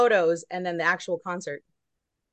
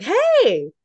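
higher, louder friendly hey; imitating a warm recognizing hey; bright recognizing hey; 9 semitones higher, 6 dB louder than usual. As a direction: greet her like an old friend. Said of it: "he's like".

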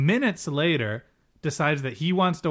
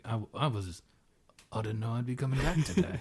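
low, soft grumbly man's voice; mock grumbly voice, hushed and low; grumbly Bates; 3 semitones lower, 8 dB quieter than usual. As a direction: grumble it quietly under your breath. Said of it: "we always give him the grumbly voice".

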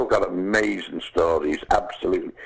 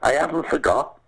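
retold shout; 7 semitones higher than usual. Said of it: "I shouted".

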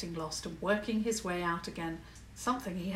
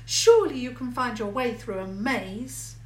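petulant; 3 semitones higher, 8 dB louder than usual. A narrator's reading. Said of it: "a little petulantly".

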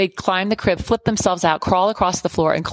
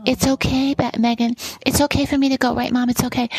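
a hint of a higher, breezy child's voice; lightly voiced little sister; 6 semitones higher than usual. Twin Rebecca reassuring her worried sister, lightly voiced child. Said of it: "And Rebecca".

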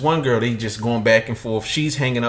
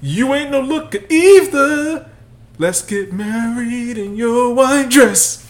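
high, slow put-on crooner voice; mock serenade; 11 semitones higher, 5 dB louder, slower than usual. Host hams up a singer proposing to his woman, high and slow.

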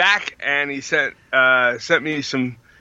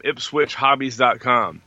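ordinary talk, nothing marked

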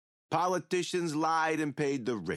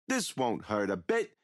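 ordinary talk, nothing marked

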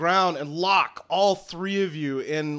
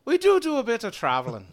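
quick, higher Irish lilt; brisk put-on Irish brogue; Irish accent; 7 semitones higher, 3 dB louder, faster than usual. Druid telling newcomers he travels, Irish accent. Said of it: "you sound like an Irishman".

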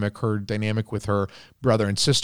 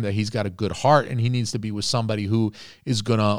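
ordinary talk, nothing marked

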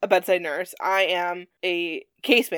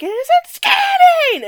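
high, loud panicked yell; mock meltdown scream; frantic shout; 10 dB louder, much slower than usual. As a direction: shriek it like a swamped lab tech. Lab staff voiced in frantic scene.

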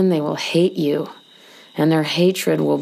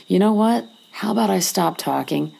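plain reading voice

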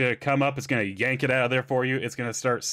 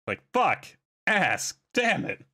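mock robot cursing in short bursts; cussing robot; 7 semitones higher, much slower than usual. Folding robot cussing out its owner, playfully exasperated. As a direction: pretend little machine swearing, climbing higher at the end. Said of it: "cussing me out".

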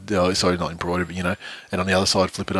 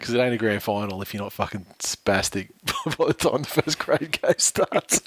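laughing as the line ends; 3 semitones higher than usual. Real, not acted. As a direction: giggle through the ending. Laughing at his own joke.

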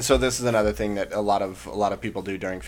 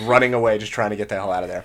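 normal talking voice

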